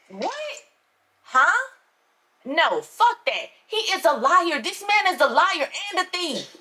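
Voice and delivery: high, rising disbelief into protest; shocked denial. Acted. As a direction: refuse to accept it, voice climbing. Shocked then denying.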